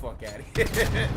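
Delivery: drawn-out dismissive "Fuck outta here". Real, not acted. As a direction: brush him off slowly, scoffing. Dismissive retort.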